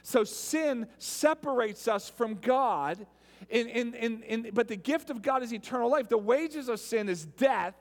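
loud, driving sermon delivery; full-force pulpit voice; preaching. Acted. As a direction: preach it to the whole room.

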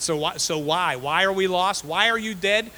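booming preacher demand; preaching loud. Real, not acted. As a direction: drive the question home from the pulpit. Preacher building rhetorical questions.

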